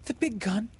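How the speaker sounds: put-on high-pitched child's startled question; high, childlike alarm. A narrator's reading. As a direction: ask it high, taken aback. A child reacts to pirates' cannon.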